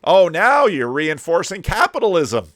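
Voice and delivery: loud, high mock protest; mock outrage. Commentator heckling the lecture about hiring teenagers, in mock outrage. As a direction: cry out in put-on indignation.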